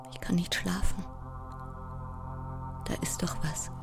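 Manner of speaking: put-on frightened child. Ten-year-old afraid to sleep after Mama's death, fearful hush. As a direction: whisper into the dark like a scared child.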